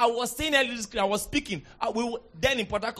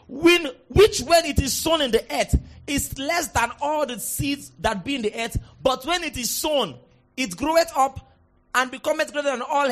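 raised, solemn Bible reading; scripture reading; 5 semitones higher, 5 dB louder than usual. Acted. Pastor reading Mark to congregation, formal. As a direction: read the verse formally.

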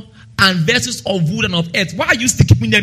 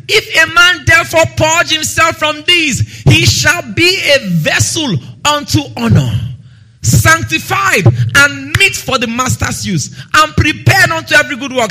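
emphatic preaching; 6 semitones higher than usual. Acted. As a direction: proclaim it with full force. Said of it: "second emphatic reading of the verse".

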